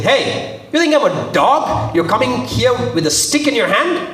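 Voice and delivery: high-pitched indignant questioning; scornful challenge. Retold or imitated. Goliath looking at David and his stick, scornful. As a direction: act out an insulted fighter's disbelief.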